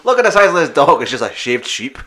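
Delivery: loud shady showman breaking into laughter; sketchy circus barker. Acted. Rizzo brother showing off a dog, sketchy barker voice. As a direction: start high and booming, then crack up. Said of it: "why they've got that accent".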